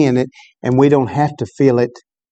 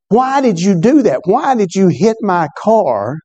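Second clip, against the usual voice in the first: much higher, slow, outraged questions; furious questioning; 6 semitones higher than usual. Retold or imitated. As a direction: hurl the questions at the boy in anger. Furious businessman confronts the boy.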